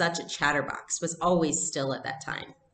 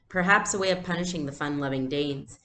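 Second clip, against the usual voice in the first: ordinary delivery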